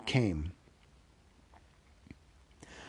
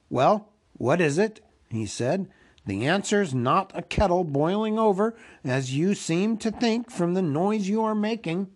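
higher-pitched, impatient hobbit; 6 semitones higher than usual. Acted. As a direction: press for an answer with rising impatience. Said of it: "Bilbo became impatient".

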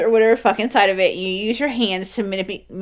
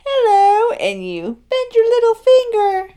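high squeaky little puppet voice; finger puppet voice; 5 dB louder, slower than usual. Shown as a demonstration. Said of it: "that's the voice you do".

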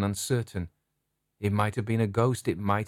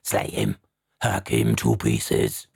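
much lower, rough savage battle cry; savage ghost cries; 11 semitones lower, slower than usual. Acted. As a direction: roar it like bloodthirsty ghosts. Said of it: "crying aloud in their own tongue".

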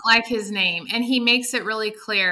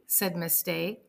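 normal talking voice

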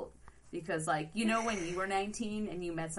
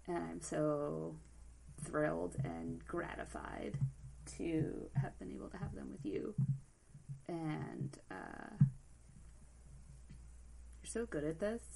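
holding back tears; 5 dB quieter than usual. Real, not acted. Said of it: "now I'm gonna cry in the middle".

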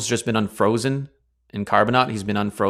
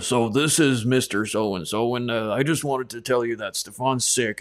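friend faking a dad; 2 semitones higher than usual. Retold or imitated. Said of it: "pretend you're my dad".

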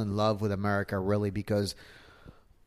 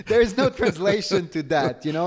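laughing; 8 semitones higher, 4 dB louder than usual. Real, not acted. Laughing at the phrase.

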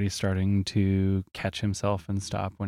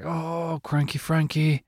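higher, laid-back surfer dude; 8 semitones higher, slower than usual. Retold or imitated. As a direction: imitate a chill surfer.

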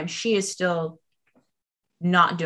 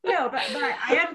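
holding back tears with a laugh; shaky sigh; 8 semitones higher, 3 dB louder than usual. Real, not acted. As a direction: voice cracking between laughs. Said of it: "I'm trying not to cry".

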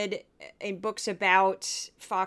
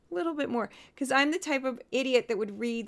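normal talking voice

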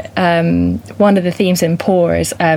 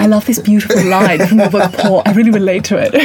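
posh voice; 3 semitones higher, 3 dB louder than usual. Pretend. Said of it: "me in my, posh voice".

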